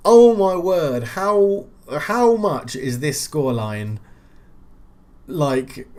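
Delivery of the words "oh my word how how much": higher, louder astonished gasp of joy; can't believe it happened; real surprise at the late winner; stunned delight. Real, not acted. Reacting to late goal.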